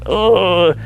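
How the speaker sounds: a dad groaning while stuck waiting in line; frustrated groan. Retold or imitated. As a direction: let out a fed-up groan. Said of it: "sad or mad or disappointed".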